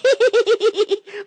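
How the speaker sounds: high cartoon cackle; greedy high cackle; gleeful cackle. Gloating over the fish.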